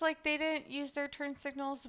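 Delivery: ordinary delivery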